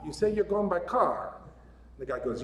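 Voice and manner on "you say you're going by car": put-on a light, slightly higher Irish brogue for old Paddy; a modest stab at an Irish accent. Old Irishman Paddy answers a lost tourist in a light Irish accent. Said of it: "I don't know if my Irish accent's any good".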